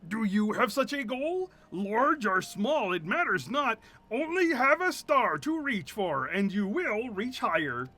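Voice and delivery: lofty, measured mentor voice, slow on the middle line; solemn storybook sage; wise old mentor. Acted. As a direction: deliver it like sage counsel from an elder. Wise NPC mentor lines.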